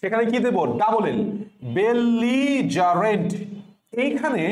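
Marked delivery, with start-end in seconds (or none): 1.8-3.3 "বেলিজারেন্ট" as bel-lee, ja, rent, very slow; careful stretched model of "বেলিজারেন্ট"; syllable-by-syllable pronunciation. Shown as a demonstration. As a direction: slowly draw out "বেলিজারেন্ট" in syllables. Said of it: "যেখানে আমি পড়ছি বেল".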